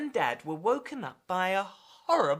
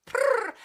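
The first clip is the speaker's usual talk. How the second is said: high rolled purr; 5 dB louder than usual. Acted. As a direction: purr it out like a cat. Girl playing cat, purring.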